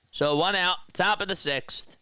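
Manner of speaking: mock baseball play-by-play call; casual live baseball commentary. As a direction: call the game like a radio announcer. Announcer call.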